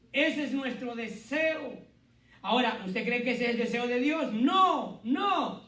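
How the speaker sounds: loud, high sermon voice, slowing on the Lord's words; preaching at full force. Acted. Pastor urging the congregation to forgive, full preaching voice. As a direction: drive the point home from the pulpit.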